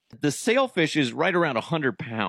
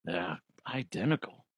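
normal talking voice

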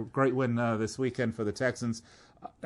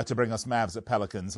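usual speaking voice

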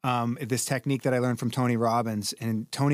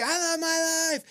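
very high, shouted affirmation; screamed at the ceiling; harder scream. Shown as a demonstration. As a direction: belt it out harder. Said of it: "fucking harder".

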